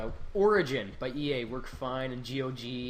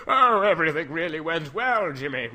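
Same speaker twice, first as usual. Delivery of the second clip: mustache twirling villain; 2 semitones higher, 8 dB louder than usual. Pretend. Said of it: "I'll be sitting on my porch twirling my mustache and be like".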